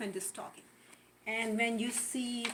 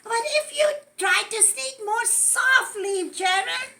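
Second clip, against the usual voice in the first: high, loud, squeaky piggy voice; 9 semitones higher, 10 dB louder than usual. Acted. Piggy calling to her elephant friend, in a high, loud piggy voice. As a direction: pipe up brightly as a little pig.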